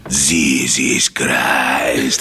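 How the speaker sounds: put-on low, very slow Greek-accented oath; scary Greek dad, deep and stretched; dad's Greek accent. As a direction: drag it out like a mad Greek father. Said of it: "this is the way he would say it".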